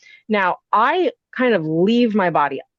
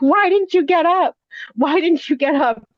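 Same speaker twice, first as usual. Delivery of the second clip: retold angry yell; 9 semitones higher than usual. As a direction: shove the words at him, shrill with panic. Hitting boyfriend, demanding why.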